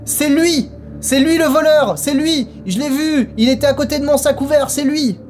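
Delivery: put-on high old lady voice, pointing the finger; accusing old lady. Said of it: "La vieille dame me regarde et me pointe du doigt".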